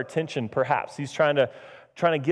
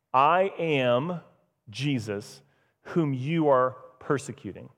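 solemn declaration; 2 semitones lower, slower than usual. Acted. Jesus reveals himself to Saul, solemn and measured. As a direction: declare it gravely, letting each phrase land. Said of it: "He's not saying, like".

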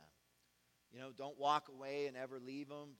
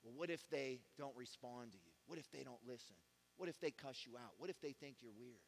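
voice of fear; 3 semitones lower, 9 dB quieter than usual. Retold or imitated. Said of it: "here's what fear will tell you".

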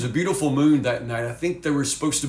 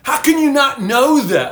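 incredulous and much higher, louder; mocking disbelief; 8 semitones higher, 7 dB louder than usual. Teen ridiculing a friend about the moon, loud mock disbelief. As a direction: pile on the friend, amazed he doesn't know. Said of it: "they just dog him".

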